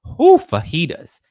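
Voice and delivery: high, loud, drawn-out silly voice; hammed-up silly voice; wacky catchphrase. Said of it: "And it's like".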